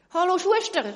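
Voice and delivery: loud, high greeting across the water; calling out. Acted. Doctor hailing the shoemaker, loud call. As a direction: hail him from the other boat. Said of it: "ruft eine andere Stimme".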